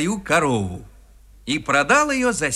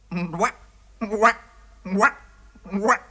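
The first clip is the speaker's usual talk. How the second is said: stubborn, evenly spaced frog croaks; croaking frogs; 4 semitones higher, slower than usual. A narrator's reading. Frogs stubbornly stick to their count, croaking. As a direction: croak it in short separate bursts. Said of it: "Но лягушки остались при своём".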